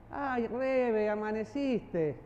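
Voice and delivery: slow, drawn-out teasing amaneciste; sarcastic tone. Shown as a demonstration. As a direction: stretch amaneciste slowly, mocking. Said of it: "¿Cómo cambia?".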